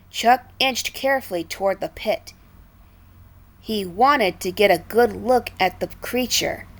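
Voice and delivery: plain reading voice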